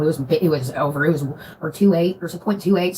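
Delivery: normal talking voice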